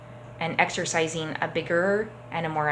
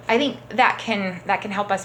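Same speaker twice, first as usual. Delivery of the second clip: ordinary delivery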